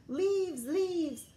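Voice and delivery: high, loud gleeful cry; child shouting for joy at falling leaves; excited cry. A narrator's reading. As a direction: call out the leaves with delight. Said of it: "cried Froggy".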